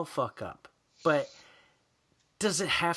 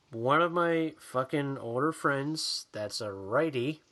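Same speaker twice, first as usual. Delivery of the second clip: normal talking voice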